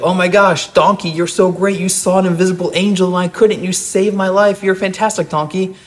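high, grateful rush of praise; amazed gushing. Acted. Balaam, amazed, gushes high in praise to his donkey. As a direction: gush over the donkey as if it saved him. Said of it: "And Balaam's like".

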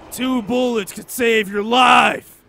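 slow, loud dramatic shout; Rick from The Walking Dead. Retold or imitated. Teasing a friend over wasted bullets, TV-survivor shouting. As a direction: holler each word drawn out in mock drama. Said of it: "you just see Rick from, uh, The Walking Dead".

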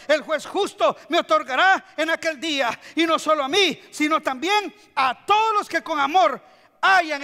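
high, driving scripture declamation; forceful preaching. Acted. Preacher declaiming Paul's farewell to congregation, forceful. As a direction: build phrase by phrase to a loud proclamation.

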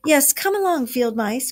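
high, eager invitation. A narrator's reading. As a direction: pull them in, eager and glad. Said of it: "cried the mole eagerly".

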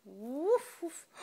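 little high woofs; playful puppy imitation; puppy bark. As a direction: woof like a puppy. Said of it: "Puppy go".

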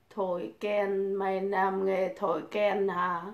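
soft, slow read of the viewer's jibe; quiet, slow read-out of the chat line; reading the insult. Retold or imitated. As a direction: read the question back under your breath. Ừ, tao làm nghề thổi kèn đấy.

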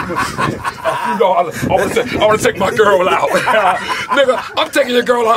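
amused jeer, laughter breaking into the words; laughing taunt. Real, not acted. Caller mocks Don Juan over taking his girl out, laughing. Mocking a rival, laughing along.

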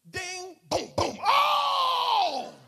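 loud, high mock ding and boom; bell ding and punch booms. Pastor acting out the punch for the church, booming. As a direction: hammer out the knockout noises.

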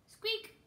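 high squeaky mouse sound; mouse squeak. A narrator's reading. Mouse answers Annie.

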